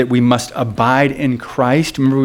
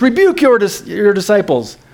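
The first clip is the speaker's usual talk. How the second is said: high-pitched imitated indignation; indignant; 7 semitones higher than usual. Religious leaders complaining to Jesus about his followers, acted indignant. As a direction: demand it like an offended religious leader. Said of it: "remember they were indignant".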